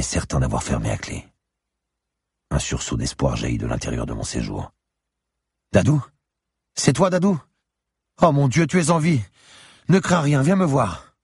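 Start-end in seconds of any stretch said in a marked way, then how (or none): 5.7-11.1 very high, louder anxious cry; hopeful calling out. Acted. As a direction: call out high with sudden hope and relief. Hero calls through door, overjoyed, hopeful. (appel aigu, plein d'espoir)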